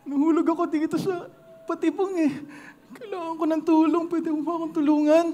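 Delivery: high, small pleading voice; pleading mouse. Acted. Tiny mouse begging the lion to free it, high and pleading. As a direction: beg the lion for help.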